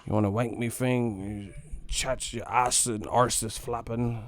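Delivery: slow, hammed-up English accent; mock British slang. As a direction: parrot English insults he didn't understand. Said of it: "this shit sounded good".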